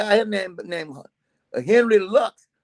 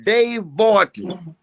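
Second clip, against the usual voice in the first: slow and clear; much slower than usual. Shown as a demonstration. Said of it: "Hope you can see this".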